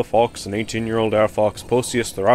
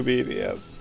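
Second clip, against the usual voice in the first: ordinary talk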